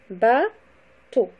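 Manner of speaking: "Bateau" split into ba, pause, teau; syllable by syllable. Shown as a demonstration. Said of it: "le découpage syllabique".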